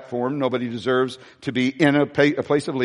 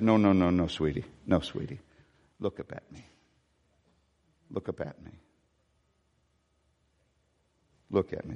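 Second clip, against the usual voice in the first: soft, much lower, almost whispered comfort; tender hushed daddy voice; 8 semitones lower, 9 dB quieter than usual. Acted. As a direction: coax a child to look up, barely audible. Tender daddy lifting child's head.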